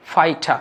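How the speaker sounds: ফাইটা with t and no final r; British pronunciation. Shown as a demonstration. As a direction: say ফাইটা ending in open "ta". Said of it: "রটা silent করলে".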